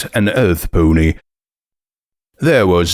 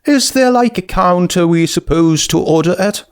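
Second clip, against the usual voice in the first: a much higher, bubbly voice, quickly sorry; high, eager skeleton girl; 10 semitones higher than usual. Acted. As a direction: gush with sudden friendly excitement. Skeleton asks about counter.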